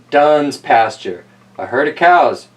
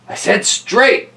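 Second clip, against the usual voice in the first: slow, higher insistence; insistent repeat; 8 semitones higher, slower than usual. Acted. Albert repeats his order to the crew, slow and insistent. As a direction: say it again, firmer. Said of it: "he repeated".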